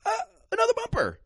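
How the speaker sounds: very high, giddy dog voice; excited dog. Acted. Trainer playing a dog finding bumper after bumper, wildly excited. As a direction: squeal over each find like an overexcited dog. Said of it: "is excited and is like".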